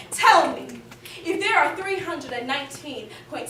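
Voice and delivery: fierce spoken-word indictment; impassioned plea. Acted. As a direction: call out Lady Liberty, demanding answers. Impassioned address to America.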